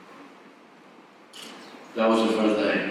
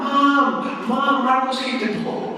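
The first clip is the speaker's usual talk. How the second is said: calling mom; 14 semitones higher, slower than usual. Retold or imitated. Sister running to mom after being hit. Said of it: "she ran out".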